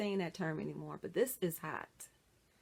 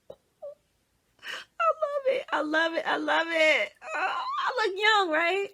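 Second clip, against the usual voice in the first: loud, high shrieks of joy, laughing into it; excited screaming; 8 semitones higher, 7 dB louder than usual. Real, not acted. Woman trying on a wig for viewers, screaming with joy. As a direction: squeal with delight over the wig. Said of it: "I'm screaming".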